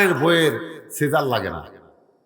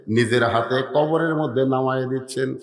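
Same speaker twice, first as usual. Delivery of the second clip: ordinary talk, nothing marked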